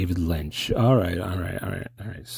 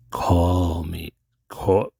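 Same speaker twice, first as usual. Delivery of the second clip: Mystery Man voice; 2 semitones lower, much slower than usual. Retold or imitated. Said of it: "I can't do Mystery Man's voice".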